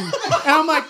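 normal talking voice

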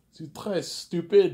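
slow high French "C'est très stupid"; a high, drawn-out French jab; mock French scolding. Coworkers mock her in French, high and slow. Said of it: "They tell her in French".

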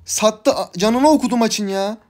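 high-pitched angry complaining; frustrated rant. Real, not acted. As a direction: vent at useless teammates in a high, loud whine. Raging at teammates after loss.

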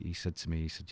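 ordinary talk, nothing marked

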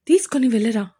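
"తీసుకొని వెళ్ళరా?" whined like a put-on sob; hammed-up sulky whimper; fake crying. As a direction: pretend to be hurt, a hint of mock tears. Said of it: "ఏడుపు నటించింది అశ్వి".

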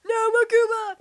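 put-on high, loud shout at a kid; scolding shout. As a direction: cry out to forbid it. Acted scolding after softer name.

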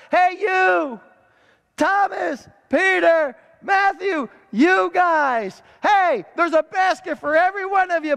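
rally the disciples by name; Jesus shouting to his disciples about baskets; excited calling out. Acted.

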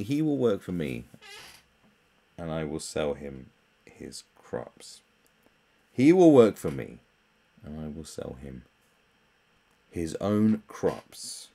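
"will" stressed, "his own crops" stretched slowly; emphatic mocking reread. Shown as a demonstration. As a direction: drag out "his own crops" to expose the nonsense. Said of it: "So basically".

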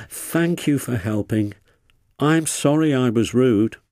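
ordinary delivery, nothing marked

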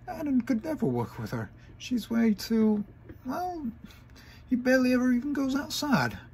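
high, stunned pony voice; high incredulous mare voice; disbelief. Acted.

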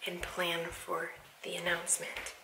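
soft whisper; real hushed plotting; secret whisper. Real, not acted. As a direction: whisper so no one hears. Whispering her plan.

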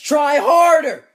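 very high, very loud, slow scream; furious shout. Pretend. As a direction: scream it at the teammate. Yelling at partner losing game.

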